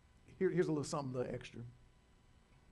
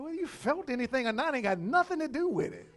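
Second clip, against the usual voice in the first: dismissive retort; 7 semitones higher, 6 dB louder than usual. Retold or imitated. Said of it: "I was like".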